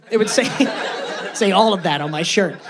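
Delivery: ordinary delivery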